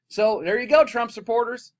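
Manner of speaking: loud, high, slowed mocking jab; sarcastic. Real, not acted. Vlogger taunting Trump supporters, loud sarcasm. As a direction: rub it in their faces.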